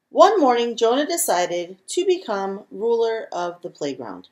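ordinary delivery

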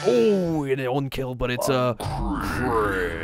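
high, loud "oh" settling into a drawn-out call; excited crit call. Real, not acted. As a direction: announce it big, stretching out the last word. Excited announcing of a dice result.

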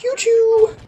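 high squeaky mock voice; mock enemy reply. Retelling the enemy's reply, high squeaky mock voice. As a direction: squeak it like a cartoon. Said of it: "And they're all like".

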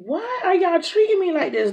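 high-pitched, baffled retold complaint; upset protest. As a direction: push the voice up in disbelief. Retold protest to soothing staff.

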